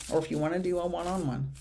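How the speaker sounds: a quick confiding whisper; whispered aside. Real, not acted. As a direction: let it slip out fast and hushed, just for her. Private aside to a viewer.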